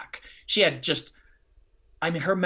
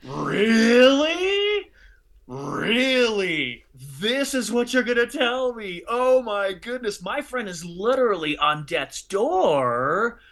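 indignant disbelief; 7 semitones higher, much slower than usual. Retold or imitated. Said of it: "I was like".

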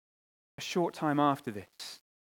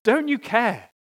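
loud, higher anguished plea to God; crying out to God; 7 semitones higher, 7 dB louder, slower than usual. Retold or imitated. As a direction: cry it out as if the boat is sinking. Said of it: "you cry out to God".

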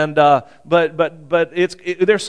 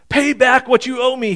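higher, harsh demand; 8 semitones higher than usual. A narrator's reading. Servant choking his fellow servant, angry demand. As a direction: bark the debt at him. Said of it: "he demanded".

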